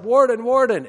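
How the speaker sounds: high, loud calling out after someone; eager call. Retold or imitated. Nun running up to the warden, high excited call. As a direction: Austrian nun chasing the warden, calling loudly.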